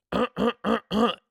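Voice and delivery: loud throat clear. Real, not acted. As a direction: clear your throat hard. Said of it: "let me clear my throat".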